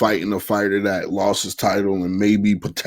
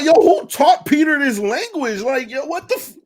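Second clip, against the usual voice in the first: high, loud shocked disbelief; high-pitched shocked yelp; 4 dB louder than usual. Retold or imitated. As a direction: squeal it in disbelief. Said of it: "I was shocked".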